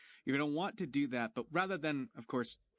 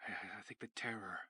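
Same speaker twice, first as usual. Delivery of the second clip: unvoiced, much softer; whisper; 3 semitones lower, 10 dB quieter than usual. Real, not acted. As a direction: whisper it, trailing off. Whisper at end.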